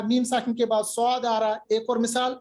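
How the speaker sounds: usual speaking voice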